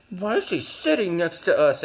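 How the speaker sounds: hammed-up annoyed mother voice; mock annoyed mom. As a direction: imitate an irritated mom. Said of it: "She's like".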